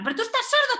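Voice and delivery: high-pitched incredulous reprimand; exasperated scolding. Acted. Parent scolds a child who ignores them, exasperated. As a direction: fire it off, exasperated.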